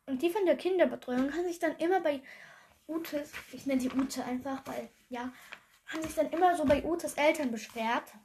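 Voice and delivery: ordinary talk, nothing marked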